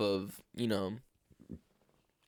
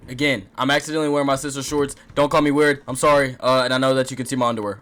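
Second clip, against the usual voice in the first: loud embarrassed rush; 16 dB louder, faster than usual. Real, not acted. Embarrassed rushed opening, loud.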